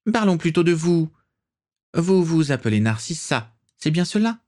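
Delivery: put-on imperious old aristocrat, a touch theatrical; haughty old matriarch. A narrator's reading. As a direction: look down on the young bride. Prisca voice continues.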